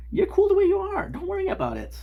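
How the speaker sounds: very high, squeaky-pitched reassurance; high put-on voice. Streamer reassuring someone who wishes to be French, high voice. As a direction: pitch it way up, playfully.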